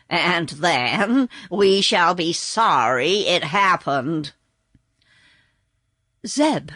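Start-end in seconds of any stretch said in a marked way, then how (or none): 0.1-4.3 slow, gruff horse voice; gloomy growl. Acted. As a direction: grumble it darkly. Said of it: "growled the horse".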